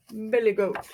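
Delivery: ordinary delivery, nothing marked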